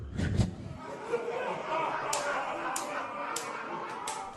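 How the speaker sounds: high, breathy laugh. Real, not acted. Comedian after a hard line to hear, laughing. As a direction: let a long laugh out.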